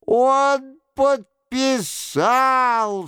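high, long-drawn exclamation; triumphant cry. A narrator's reading. Monk announces the king has signed, crying out. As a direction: cry it out high, stretching every word. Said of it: "вскричал Горанфло".

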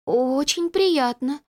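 slow, nervous mumble; mumbling it out, scared; frightened mumble. A narrator's reading. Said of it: "пробормотала Людочка, испуганно разглядывая".

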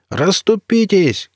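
high, drawn-out call to clear the way; stretched high cry from a driver; shouted warning. A narrator's reading. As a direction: yell at people to move aside. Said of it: "кричал водитель".

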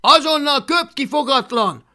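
loud, high yelled order; shouted command. Acted. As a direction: bellow sternly at a naughty pet.

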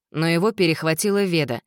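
ordinary delivery, nothing marked

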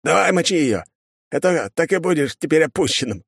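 high-pitched, egging him on; goading. Acted. Guard eggs his mate on to shoot bird, goading. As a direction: urge a mate on mockingly.

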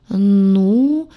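long, loud, rising "well"; thoughtful drawl. A narrator's reading. Heroine cautious not to spoil the deal, drawling thoughtfully. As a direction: draw it out, pondering cautiously. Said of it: "протянула я задумчиво".

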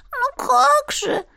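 very high, slow, bewildered; lost little animal voice; bewildered otter voice. A narrator's reading. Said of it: "растерянно прошептала она".

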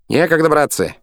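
high, loud shout. A narrator's reading. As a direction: call it out over the work. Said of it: "кричал Пахомыч".

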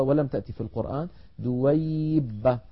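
ordinary talk, nothing marked

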